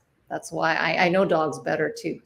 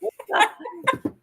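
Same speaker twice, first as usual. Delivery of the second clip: a high real giggle. Real, not acted. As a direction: let out a quick high giggle. Guest laughs at joke.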